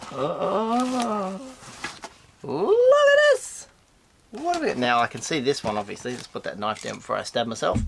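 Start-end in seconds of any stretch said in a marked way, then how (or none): 0.1-3.7 much higher, louder and slow; delighted exclaim. Real, not acted. Host finding a toy in the fan mail, high and slow squeal. 4.3-4.8 much higher pitched; excited question. Real, not acted. Host digging into the box, high and eager.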